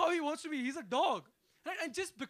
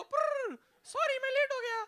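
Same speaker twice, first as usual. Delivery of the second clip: lift answering; 11 semitones higher than usual. Retold or imitated. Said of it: "It's not gonna go".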